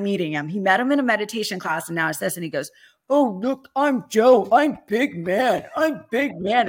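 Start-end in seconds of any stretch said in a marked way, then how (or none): 3.1-6.7 high, loud mock-macho taunt. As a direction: imitate someone teasing a big guy, slow and swaggering. Brian teasing big husband, retold.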